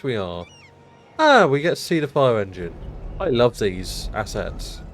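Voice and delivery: normal talking voice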